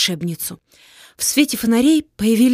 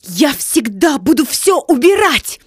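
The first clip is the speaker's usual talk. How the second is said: slow, loud, deliberate cry; shouted vow; 4 semitones higher, 3 dB louder than usual. Acted. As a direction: proclaim the spell together. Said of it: "закричал Вася".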